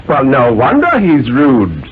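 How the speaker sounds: a lightly voiced stern, reasoning father; a hint of a condescending father; father's voice. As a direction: coax the boy like a patient but superior dad. Father rebukes mother.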